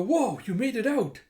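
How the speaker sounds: high, loud mock astonishment; acted amazement. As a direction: exclaim like an amazed onlooker. Bystander amazed at the escape.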